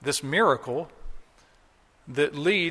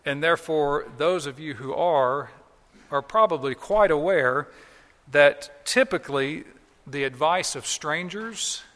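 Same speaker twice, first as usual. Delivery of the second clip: usual speaking voice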